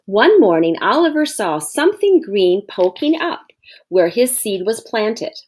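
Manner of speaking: usual speaking voice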